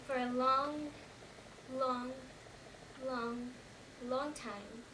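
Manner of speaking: slow repeats with long pauses, fading away; drawn-out, fading. Acted. Survivor recalling the stillness after the crash, slow and fading. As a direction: stretch each "long" and leave silence between.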